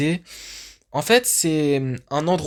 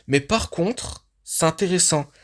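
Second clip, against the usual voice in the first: ordinary talk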